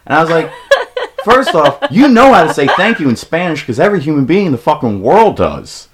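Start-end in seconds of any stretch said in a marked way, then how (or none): 1.2-5.9 loud, high-pitched mock tirade; exasperated scolding. Storyteller acts out scolding friend over Spanish, exasperated tirade. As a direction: scold in loud, laughing disbelief.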